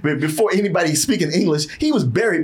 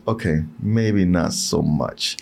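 deflated; 11 semitones lower, slower than usual. Retold or imitated. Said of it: "it was like".

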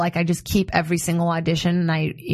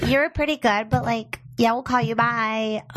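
casting director voice; 4 semitones higher, slower than usual. Acted. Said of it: "casting director impression".

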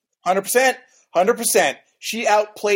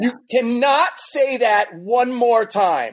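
slow, high-pitched mock outrage; hammed-up, drawn-out telling-off; exasperated scolding; 7 semitones higher, slower than usual. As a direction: lay down the law slowly, pitch climbing to a squeal. Acting out fed-up reaction to Juan Pablo.

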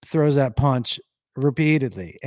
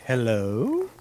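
put-on British accent; 6 dB louder than usual. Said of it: "my asshole is talking with a British accent".

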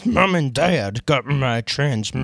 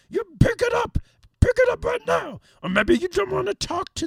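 high-pitched insistent command; high ringtone voice; 13 semitones higher than usual. Pretend. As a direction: squeak it up high. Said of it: "listen to a few of the ringtones".

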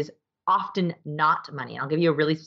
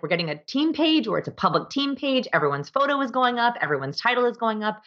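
higher, a touch of upbeat enthusiasm; lightly eager announcement; 7 semitones higher than usual. Retold or imitated. Boss telling her team everyone goes on the website, mildly eager. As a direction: announce the fix with mild gusto.

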